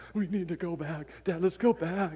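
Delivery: high, urgent daughter begging dad; pleading to leave. Retold or imitated. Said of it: "Kaylee's like".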